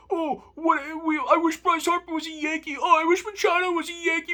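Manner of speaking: sarcastic high-pitched imitation of a complaining fan; mocking whiny fan. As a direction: parody the fans wishing for other players. Mocking whiny fans' what-ifs.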